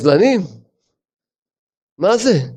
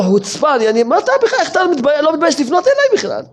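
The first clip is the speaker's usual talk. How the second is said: high, hammed-up offended outrage; mock indignant debtor; 10 semitones higher than usual. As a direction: play the debtor who scolds his creditor, mocking. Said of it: "עוד אומר לו". (חיקוי לועג של חייב נעלב, בקול גבוה)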